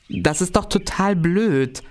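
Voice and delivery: put-on high, slow childish grumble; grumbling boy. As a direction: whine it like a sulky kid.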